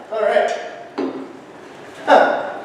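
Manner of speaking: ordinary talk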